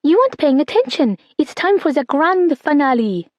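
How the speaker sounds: put-on a small girl scolding her sister in play; child voice. A narrator's reading. Little sister insists on the finale, lightly voiced girl.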